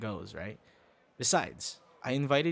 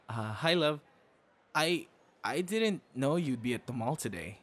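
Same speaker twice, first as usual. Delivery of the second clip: ordinary delivery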